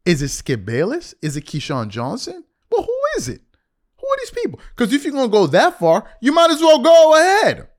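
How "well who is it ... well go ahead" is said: high-pitched, incredulous demand; exasperated challenge. Acted. Host daring LeBron to name his critics, exasperated. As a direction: push the challenge up high, louder at the end.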